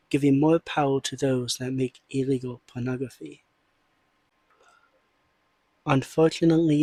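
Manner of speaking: ordinary talk, nothing marked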